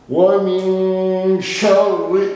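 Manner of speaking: शर्रि with the shin pressed and the r doubled; heavy shin in शर्रि. Shown as a demonstration. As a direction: lean hard on the श in शर्रि. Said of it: "शीन को जोर से लगाएंगे". (शर्रि में शीन ज़ोर से)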